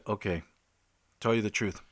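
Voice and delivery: mock menacing threat. Young surveyor spooking the paranoid caller, low mock threat. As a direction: drop low like a horror-movie stalker. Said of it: "I got raw on him".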